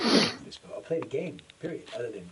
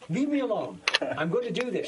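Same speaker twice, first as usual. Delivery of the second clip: higher, louder mock snapping; retold irritated outburst; 6 semitones higher, 6 dB louder than usual. Gamer dad retells brushing off his daughter, mock exasperated. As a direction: fend off an interruption, comically exasperated.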